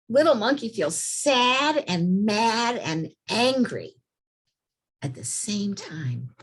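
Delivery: slow, high, sing-song storybook voice; reading to children. A narrator's reading. Teacher reading a picture book to little kids, slow and sing-song. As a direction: draw out the sad monkey like a picture-book reader.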